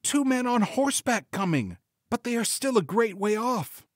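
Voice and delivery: high-pitched storybook sister reporting back; watchful sister Anne. A narrator's reading. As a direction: answer in a high lady's voice.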